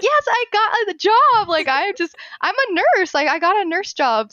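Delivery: loud, high, triumphant outburst; reliving the thrill at full volume; pumped up. Retold or imitated. New nurse telling the server her news, pumped up. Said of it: "I was just so pumped up".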